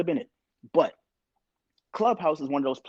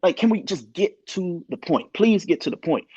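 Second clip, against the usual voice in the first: higher-pitched mock bewilderment, then pleading; retold impatience, words dragged out for emphasis; exasperated; 5 semitones higher than usual. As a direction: plead with them to hurry up. Acting out his exasperation at the room.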